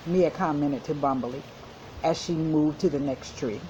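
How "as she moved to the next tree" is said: plain reading voice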